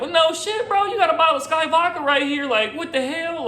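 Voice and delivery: high, incredulous "no shit, bro". Retold or imitated. Narrator to friend holding vodka, incredulous and mocking. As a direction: throw her own bottle back at her in disbelief. Mocking her rehab second time.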